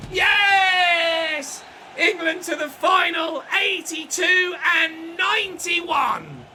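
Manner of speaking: huge loud roar of victory; triumphant yell. Real, not acted. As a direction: scream it at the final whistle. Final whistle celebration.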